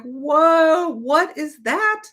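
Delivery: high, loud shocked outburst; startled at her own words; climbing to a squeaky what; alarmed. Retold or imitated. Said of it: "and I was like".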